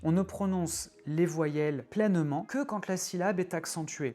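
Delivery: usual speaking voice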